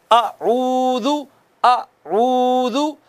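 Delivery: अऊदु drawn out slowly, lips rounded on the u; slow tajweed chant of अऊदु with rounded lips; Quranic recitation. Shown as a demonstration. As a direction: recite अऊदु slowly, stretching the first vowel. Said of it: "पढ़िएगा मेरे बाद".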